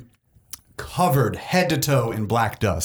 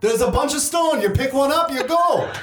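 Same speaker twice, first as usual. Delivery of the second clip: very high, dismissive character voice; irate cave woman; 13 semitones higher than usual. Acted. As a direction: wave them off as an exasperated cave dweller. Voices Fiona dismissing the request.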